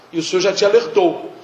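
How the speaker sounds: ordinary talk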